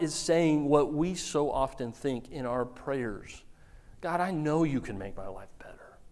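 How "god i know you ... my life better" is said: breathy whispered plea to God; whispered prayer. Acted. The crowd praying in a hushed whisper. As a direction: pray it in a hush, half to yourself.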